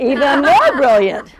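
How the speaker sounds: loud, high, triumphant echo; crowing delight. Real, not acted. Teacher echoes the audience's praise of her next piece, crowing loudly.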